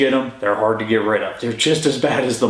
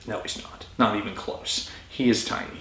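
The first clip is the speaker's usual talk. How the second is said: muttered aside; 3 semitones lower, 9 dB quieter than usual. Real, not acted. Muttered aside about seller's claim.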